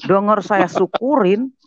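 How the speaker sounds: ordinary talk, nothing marked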